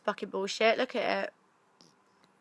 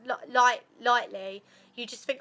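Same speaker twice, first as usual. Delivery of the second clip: ordinary talk, nothing marked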